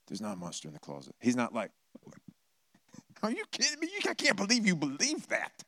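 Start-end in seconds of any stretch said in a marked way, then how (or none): 0.1-1.2 calm reassurance. Retold or imitated. Lord reassures calmly. 3.2-5.6 very high scoffing disbelief; incredulous mockery. Mocking disbelief contrast.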